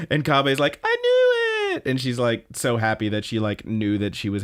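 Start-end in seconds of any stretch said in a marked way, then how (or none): 0.8-1.8 very high, drawn-out squeal; hammed-up high cartoon delight; gleeful I-told-you-so. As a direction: squeal it slowly, triumphant. Retold character's delighted outburst.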